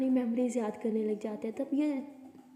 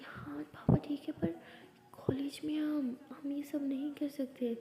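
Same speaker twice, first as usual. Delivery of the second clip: whispered protest; 3 semitones higher, 4 dB quieter, slower than usual. Acted. Whispered objection in college.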